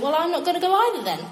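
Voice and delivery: a light huffy God; hurt God. Retold or imitated. God answering the Israelites with a mock huff. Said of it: "and he's like".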